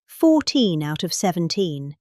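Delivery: ordinary talk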